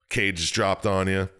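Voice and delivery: ordinary delivery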